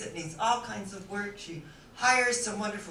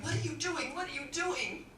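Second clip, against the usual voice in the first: higher-pitched puzzled questioning; mock bewildered calls; 6 semitones higher, 4 dB quieter than usual. Bus passengers to Frances as she jumps off, mild bewilderment. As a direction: call after her in light disbelief.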